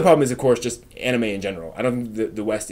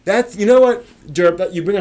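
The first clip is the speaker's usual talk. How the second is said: sudden realization; 8 semitones higher, 6 dB louder than usual. Real, not acted. Sudden idea strikes.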